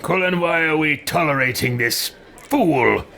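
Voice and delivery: put-on imperious character voice; contemptuous in-character complaint, landing hard on fool; scornful game hero. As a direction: demand it like an offended ruler. Player voices character's scornful reply.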